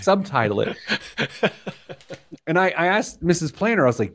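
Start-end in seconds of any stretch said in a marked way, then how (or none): 0.8-2.4 high, wheezy real laugh; helpless laughter. Real, not acted. Podcaster picturing the subtitled accent, laughing helplessly. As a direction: crack up until it turns soundless.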